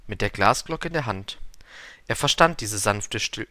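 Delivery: usual speaking voice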